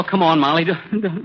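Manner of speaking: ordinary talk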